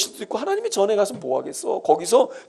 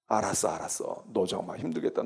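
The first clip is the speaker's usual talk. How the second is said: much softer and lower, soothing; soft, low reassurance to a hurting child; tender comfort; 10 semitones lower, 10 dB quieter than usual. Acted. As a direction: hush them gently like a parent. God tenderly comforting the sufferer.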